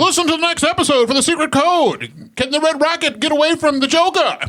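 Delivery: put-on old-time radio announcer. Mock vintage radio serial teaser.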